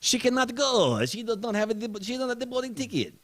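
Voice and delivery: high-pitched protesting French gate agent; mock French accent. Acting French gate agent objecting.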